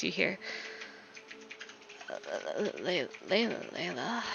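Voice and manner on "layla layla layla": drowsy, barely awake murmur; sleepy voice. Acted. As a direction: call the name half asleep. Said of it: "from his sleepy voice".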